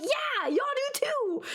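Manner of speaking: high, drawn-out retort; hammed-up squealed reply; mock defensive comeback. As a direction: fire back in comic exasperation. Acted comeback to staring women.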